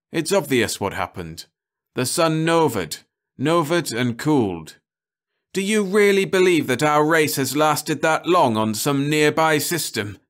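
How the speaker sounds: bitter rising rant; bitter outburst. A narrator's reading. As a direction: hurl it at them in despair. Cahill's bitter despairing outburst.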